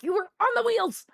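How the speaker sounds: high, loud self-defence; exasperated mock shout. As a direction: protest loudly to an accusing pet. Acted argument with the cat.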